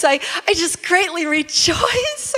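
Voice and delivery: high, gushing, over-excited delivery; hammed-up elation. Preacher picturing a person really saying the verse, hammed-up elation. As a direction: gush it as if truly thrilled. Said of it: "Picture a person really saying this".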